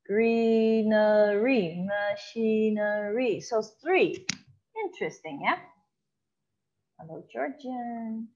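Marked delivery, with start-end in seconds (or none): 0.0-3.4 greenery drawn out very slowly; slow demo of triple rhyme; slow example words. Shown as a demonstration. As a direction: stretch each syllable of greenery.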